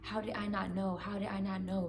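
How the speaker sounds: quiet, shaken repeating of the same question; emotional repeating. Retold or imitated. Retelling her dream, she quietly repeats her realization, softly. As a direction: murmur it to yourself, overwhelmed. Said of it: "and I was just emotional".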